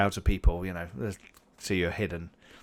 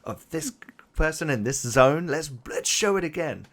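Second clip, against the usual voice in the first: higher, louder excited sportscaster patter; sports presenters; 6 semitones higher, 3 dB louder than usual. Acted. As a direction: hype up the race like a TV sports host. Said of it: "the sports people".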